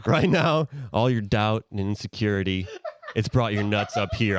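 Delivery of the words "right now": excited. Acted. Pitch pitch to investors.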